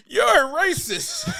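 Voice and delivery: very high-pitched accusing yell; hammed-up high accusation; mock heckler yell. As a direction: imitate an outraged woman heckling.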